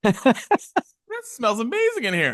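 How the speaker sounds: slow, high-pitched savoring; acted delight. Speaker acting out walking into the scented house, delighted. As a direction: draw it out high, as if taking in the smell.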